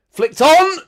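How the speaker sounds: a sudden, very high, very loud yell of excitement; excited shout. Real, not acted. Fan watching a chance, shouting in excitement. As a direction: leap up yelling as the ball is flicked on.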